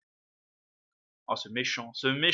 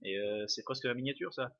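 usual speaking voice

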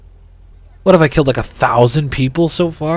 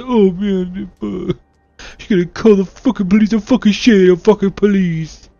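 high, hammed-up Italian panic; stressed-out Italian accent; 7 semitones higher than usual. Gamer voicing the hero fretting about police, mock Italian accent. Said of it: "I don't know why he's Italian when he gets stressed out".

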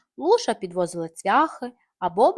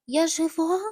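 ordinary delivery, nothing marked

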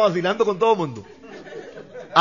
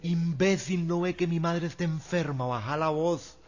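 angry, much softer hiss; furious but hushed; 2 semitones lower, 11 dB quieter, slower than usual. Retold or imitated. Wife scolding her drunk husband in a hushed, angry voice. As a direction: scold him through your teeth, keeping it down. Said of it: "pero bravísima".

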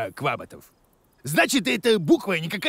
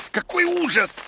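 much higher horrified cry; shrieked horror; 11 semitones higher than usual. Acted. Boda shrieks in horror at the parcel.

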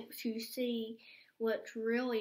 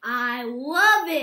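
much louder and slow, bursting with delight; excited outburst; 14 dB louder than usual. Acted. Little bunny tells his mom about the bouncy castle, loud and delighted. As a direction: stretch it out like a thrilled little bunny.

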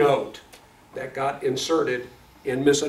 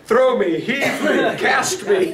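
loud, high, punchy list; hammed-up loud plea; comic pleading demand; 8 semitones higher, 6 dB louder, much slower than usual. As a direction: call out each verb for laughs. Comic list of literal renderings, laughter.

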